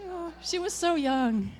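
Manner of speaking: high, slow pretend funeral lament; hammed-up eulogy wail, drawn out and loud; mock mourning. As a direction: play the grieving mourner for laughs.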